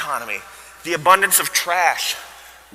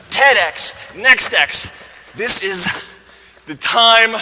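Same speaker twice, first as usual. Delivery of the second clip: loud and high, stretched out; mock motivational yell; hyped shout; 6 semitones higher, 10 dB louder, much slower than usual. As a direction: pump up the crowd. Hyping the room.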